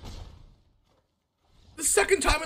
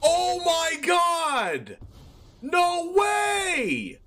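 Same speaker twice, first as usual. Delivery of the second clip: shrieking jackpot winner; 4 semitones higher than usual. Real, not acted. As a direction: yell it like you can't believe it.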